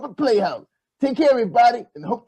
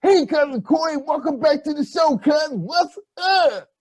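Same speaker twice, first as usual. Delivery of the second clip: put-on overbright kids party host; 4 semitones higher than usual. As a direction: welcome him like a party host. Host greets cousin on show.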